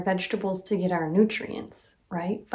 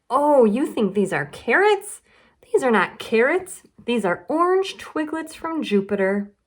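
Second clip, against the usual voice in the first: higher and louder, mock amazement; sly playful trick; 5 semitones higher, 3 dB louder than usual. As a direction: sell the fib with wide-eyed wonder. Charlie tricks Lola.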